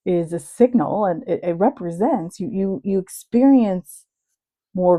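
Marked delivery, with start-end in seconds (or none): none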